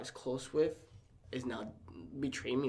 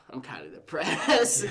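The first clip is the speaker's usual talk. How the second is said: laughing confession; 4 semitones higher, 8 dB louder, slower than usual. Real, not acted. Admitting depression with a laugh.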